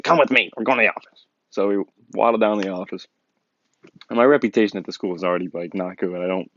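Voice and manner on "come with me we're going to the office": lightly voiced girl, higher at the start; a hint of a higher imitated voice; girl taking charge. Girl sending fighters to the office, lightly imitated. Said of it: "She's like".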